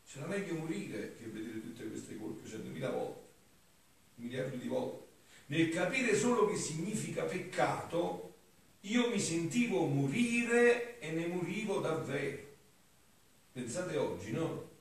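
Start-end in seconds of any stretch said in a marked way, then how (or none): 5.5-12.4 raised, loud declaiming voice; higher-pitched oratorical reading aloud; solemn devotional reading. Acted. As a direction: declaim the sacred text with fervour. Reads devotional text aloud.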